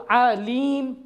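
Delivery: slow आलीम with a silent ending; pausal form. Shown as a demonstration. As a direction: stop on the m of आलीम. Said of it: "आखिर में silent कर देंगे". (रुक कर आलीम)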